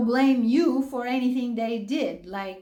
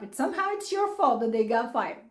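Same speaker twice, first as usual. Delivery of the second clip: laughing; 6 semitones higher, faster than usual. Retold or imitated. Laughing through retold accusation.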